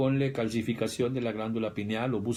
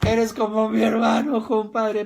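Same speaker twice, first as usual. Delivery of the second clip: put-on much louder, higher, slurring drunken affection; drunk buddy; 10 semitones higher, 9 dB louder, slower than usual. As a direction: gush like a drunk friend. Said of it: "Y ya borracho". (borracho cariñoso, muy fuerte y agudo)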